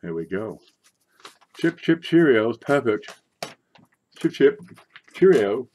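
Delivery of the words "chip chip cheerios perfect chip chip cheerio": high, loud hammed-up British accent; mock British accent. Coin seller mocking the British for viewers with a hammed-up British accent. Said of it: "Why do the British talk like that?".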